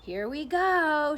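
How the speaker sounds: loud, slow, drawn-out shout. A narrator's reading. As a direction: stretch it out and shout for joy. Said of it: "shouted the old green grasshopper".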